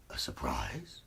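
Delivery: wolf whispering in disbelief; whispered surprise. Acted. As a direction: breathe it in wonder. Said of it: "Wally wonders".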